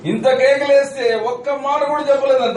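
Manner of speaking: high-pitched disbelief; amazed outcry. Real, not acted. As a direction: marvel at it aloud. Preacher marvels at silence.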